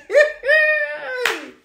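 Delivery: high squealing laugh of triumph; shrieking laugh at her rival; high mocking laugh. Real, not acted. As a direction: burst into a high gloating laugh. Gloating over a rival.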